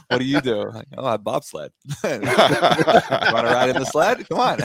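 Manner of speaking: lightly acted flirting, breaking into laughter; playful mock pickup exchange; joking pickup line. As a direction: deliver a jokey come-on through laughs. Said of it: "that is the greatest pickup line".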